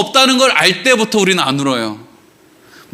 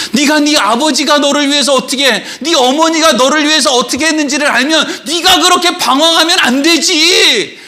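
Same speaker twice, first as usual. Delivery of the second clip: karcący starszy brat; 7 semitones higher than usual. Acted. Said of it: "형이. 방황하는 동생한테".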